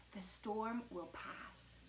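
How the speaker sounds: slow, soft comfort; reassuring. A narrator's reading. Mom shelters Victor under the table, reassuring. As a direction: soothe gently and unhurriedly.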